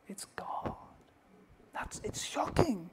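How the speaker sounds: hushed whisper on the answer; whispered reveal. Acted. Preacher to congregation, whispered reveal. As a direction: let the answer drop to a whisper.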